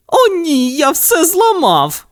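very high whining wail. Acted. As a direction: whine in dismay. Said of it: "заскиглив кіт".

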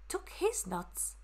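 ordinary delivery

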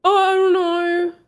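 real dismay, voice jumping up; alarmed. Real, not acted. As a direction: blurt it out in sudden alarm. Alarm at the game's warning.

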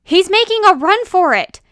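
high, loud warning cry; urgent full-volume call; alarmed shout. Acted. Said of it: "shouted Miss Vanish".

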